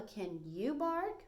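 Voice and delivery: drawn out very slowly; slow question. Shown as a demonstration. Teacher showing kids how Bella asks, very slow. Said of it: "said Bella".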